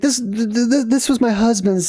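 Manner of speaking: stammering excuse. Retold or imitated. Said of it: "trying to find an excuse".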